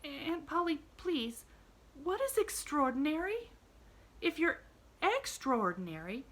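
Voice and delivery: put-on a child puzzling it out; a hint of an anxious young girl; anxious little girl. A narrator's reading. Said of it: "frowned a little anxiously".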